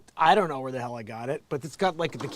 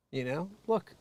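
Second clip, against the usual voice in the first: usual speaking voice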